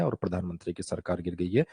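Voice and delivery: "गिर गई है" read deep and monotone, no emotion at all; deadpan the fall of the government like a bored anchor; flat newsreader. Retold or imitated. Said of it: "थोड़ा तो expression लेकर आओ".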